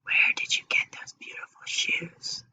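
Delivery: soft unvoiced whisper of a curious girl; Anna asks a friend in a whisper about her shoes. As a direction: whisper it like a secret compliment. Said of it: "Anna whispered".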